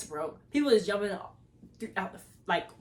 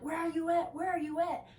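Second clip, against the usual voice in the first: mock yelling friend; 7 semitones higher, 3 dB quieter than usual. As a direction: nag in a high phone-call voice. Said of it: "she just yelling".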